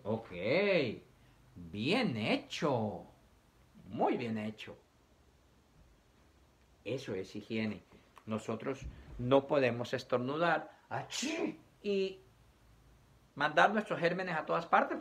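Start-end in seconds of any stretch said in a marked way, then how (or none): 0.0-4.8 happy dad. Retold or imitated. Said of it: "el papá muy feliz le dice". 10.9-11.6 quick high sneeze dropped into the sentence; mock sneeze. Shown as a demonstration. Teacher showing how not to sneeze on people, mock sneeze.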